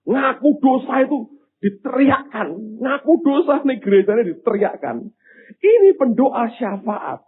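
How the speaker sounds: exasperated, high-pitched sermon voice; forceful preaching. Real, not acted. Preacher rebuking at full force.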